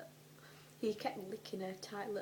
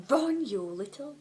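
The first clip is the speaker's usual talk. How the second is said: loud, high outraged insult; 6 semitones higher, 10 dB louder than usual. Acted. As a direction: spit the insult in fury. Hermione's outraged reply.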